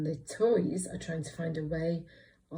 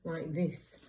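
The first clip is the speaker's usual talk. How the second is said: ordinary talk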